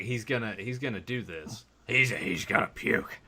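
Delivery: quiet, low, gleeful aside; gleeful whisper-like aside. Retold or imitated. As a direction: murmur it with barely held glee. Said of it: "I'm just like".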